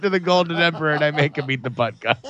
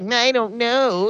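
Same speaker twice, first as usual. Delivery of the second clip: higher, dragged-out mock reluctance; mocking whine; 4 semitones higher than usual. Player mimics his wavering partner, mocking whine. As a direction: whine it slowly to mock him. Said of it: "now you're just like".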